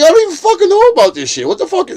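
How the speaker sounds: very high, loud, rapid protest; acted outrage. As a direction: burst out in exaggerated disbelief. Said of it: "I'm like".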